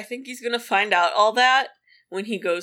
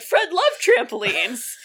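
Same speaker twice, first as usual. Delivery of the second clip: slow, squeaky and laughing through tears; squeaky, teary laugh; 2 semitones higher than usual. Real, not acted. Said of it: "I'm sorry my voice is so squeaky".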